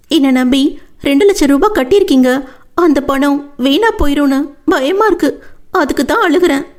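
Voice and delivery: Vennila, worried. Acted.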